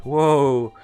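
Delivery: normal talking voice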